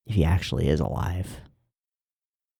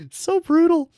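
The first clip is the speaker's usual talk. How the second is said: much higher and louder squeal; excited squeal; 5 dB louder than usual. Real, not acted. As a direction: shriek it out in delight. Reacting to the brutal line.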